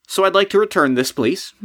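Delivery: sudden calm request. Retold or imitated. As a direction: snap back to a polite customer. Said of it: "and he says".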